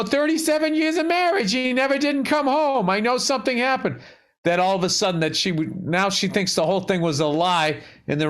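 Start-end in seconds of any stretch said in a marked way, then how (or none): 0.0-4.0 imitating grieving wife. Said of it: "'cause he's like".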